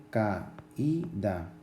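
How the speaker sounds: "caída" slowly split into three syllables, ca-í-da. Shown as a demonstration. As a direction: break "caída" apart so the í stands as its own syllable. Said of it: "la sílaba tónica está en la vocal cerrada".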